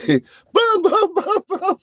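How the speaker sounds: high loud laughing booms. Real, not acted. Imitating the blasts for chat, high and laughing. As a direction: mimic blasts while laughing.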